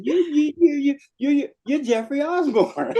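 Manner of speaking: high, stammering starstruck fan, laughing. Retold or imitated. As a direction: stumble over the words like a dazzled fan. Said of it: "I said".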